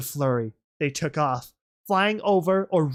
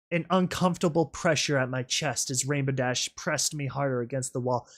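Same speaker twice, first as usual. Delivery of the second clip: usual speaking voice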